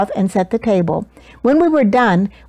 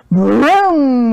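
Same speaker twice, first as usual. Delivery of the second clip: thunder sound; 3 semitones higher, 4 dB louder than usual. A narrator's reading. Thunder sound effect words.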